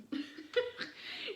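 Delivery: high breathy giggle. Real, not acted. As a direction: giggle mid-impression. Laugh within God impression.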